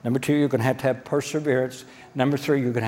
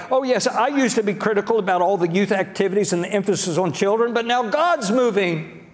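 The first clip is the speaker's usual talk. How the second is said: critics jumping on board; 6 semitones higher than usual. Acted. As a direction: imitate a critic eagerly claiming a part in it. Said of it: "They'll say something like".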